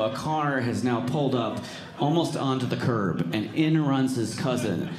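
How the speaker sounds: ordinary talk, nothing marked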